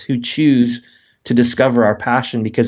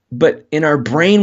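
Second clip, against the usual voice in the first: normal talking voice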